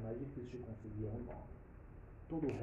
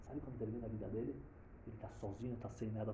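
normal talking voice

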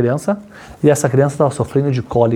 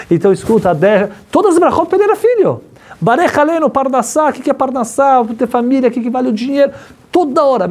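higher and higher through every blessing; preaching at full force; 9 semitones higher than usual. Acted. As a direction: pitch climbing higher with each blessing, pleading.

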